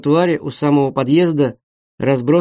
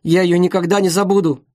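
plain reading voice

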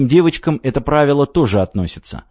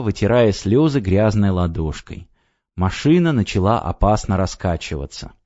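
plain reading voice

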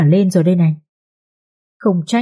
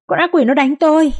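panicked scream; 9 semitones higher than usual. A narrator's reading. Mistress shrieks that the demon beats her, panicked. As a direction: shriek con ác quỷ in panic. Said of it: "kêu khóc rất thảm thương".

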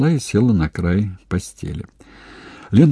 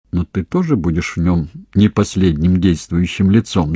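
ordinary delivery, nothing marked

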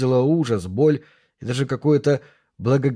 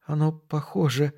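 soft, halting, higher-pitched words; forced out with difficulty; 3 semitones higher, 5 dB quieter than usual. A narrator's reading. Shocked girl tries to express her thoughts, haltingly. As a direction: squeeze out fragments between long pauses. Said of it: "с трудом выдавила Нора".

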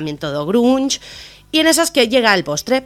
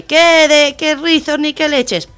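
scornful dismissal; 7 semitones higher, slower than usual. Acted. Fairy godmother rejecting Cinderella's curls, scornful dismissal. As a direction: brush the silly wish aside, indignant.

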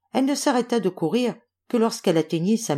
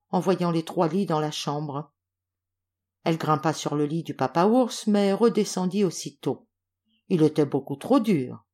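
usual speaking voice

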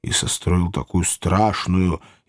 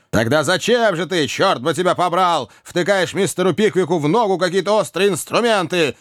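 higher, cross interrogation; angry question; 8 semitones higher than usual. A narrator's reading. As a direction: demand an answer crossly. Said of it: "сердито спросил мистер Уордль". (сердито)

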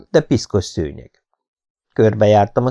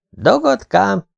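indignant high-pitched echo of the insult; offended echo; 11 semitones higher, much slower than usual. Acted. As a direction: throw the nickname back, hurt and high. (sértődött, magas visszakérdezés)

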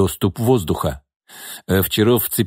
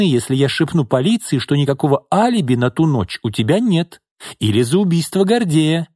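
threatening mistress; 6 semitones higher than usual. Acted. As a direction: taunt him with threats, higher. Lina voiced, high.

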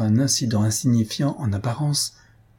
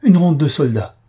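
high, loud alarmed cry; raised-voice dramatic outcry; 6 semitones higher, 8 dB louder than usual. A narrator's reading. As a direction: exclaim in sudden alarm. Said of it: "s'écria Albina".

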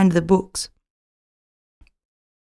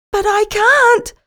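distressed cry; 12 semitones higher, 4 dB louder than usual. A narrator's reading. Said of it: "cries Blackie".

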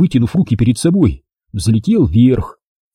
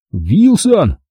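raised, higher-pitched shout, understated; loud call; 7 semitones higher than usual. A narrator's reading. Jack calls to Wilson in mid-air, raised call. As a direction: call out the name sharply upward. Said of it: "громко крикнул я".

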